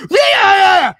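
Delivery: high, loud daft "yeah" yell; daft shout. Retold or imitated. Fan imitating YouTuber acting daft at home, high shout. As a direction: whoop out a high, mock-crazed yell.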